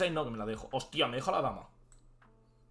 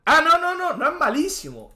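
high, loud burst of denial; emphatic protest; 9 semitones higher, 7 dB louder than usual. Real, not acted. Streamer defending his own sacrifice, emphatic protest. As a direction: jump in loudly to object.

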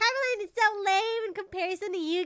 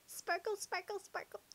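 whispered; 14 dB quieter than usual. Acted. Whispered sparkle sound effect.

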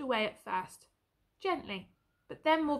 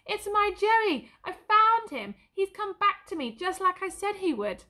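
much higher and louder, overjoyed; joyful cry; 9 semitones higher, 6 dB louder than usual. A narrator's reading. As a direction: burst out with joy at finding Joey. Albert recognises his lost horse.

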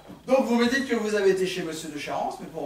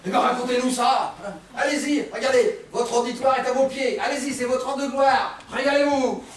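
louder, higher, mock-grand encouragement; sarcastic goading; 5 semitones higher, 5 dB louder than usual. Inspector mockingly inviting a witness to take the stage, sarcastic goading. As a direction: egg her on with loud sarcasm.

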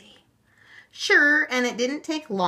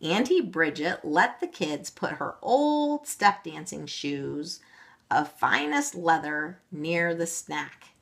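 usual speaking voice

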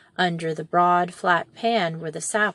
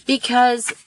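higher and louder brisk "Because"; firm, bright aunt cutting in; a lightly voiced energetic aunt; Cousin Anne; 4 semitones higher, 6 dB louder than usual.

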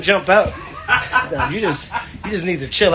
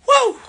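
high whooping cheer; excited woo; 4 dB louder than usual. Real, not acted. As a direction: let out a loud celebratory whoop. Hyped celebration shout.

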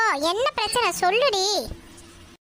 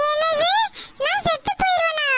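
put-on a child's startled, higher cry of alarm; shocked disbelief; 6 semitones higher than usual. Frightened girl hearing she might die, in shocked alarm. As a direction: gasp it out as if the floor just dropped.

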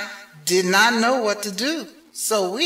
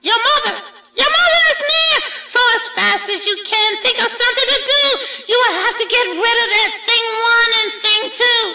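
panicked fish; 12 semitones higher, faster than usual. A narrator's reading. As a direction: gabble it out in a panic. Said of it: "said the fish".

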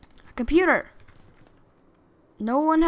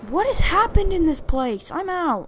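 alarmed outcry; 8 semitones higher, 8 dB louder than usual. Real, not acted. Alarmed reaction, wants out.

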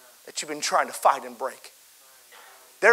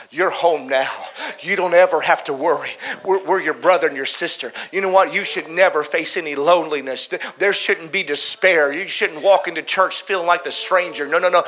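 louder, building sermon voice; rising preaching; 2 semitones higher, 10 dB louder than usual. Acted. Pastor voicing the father's welcome, building preaching.

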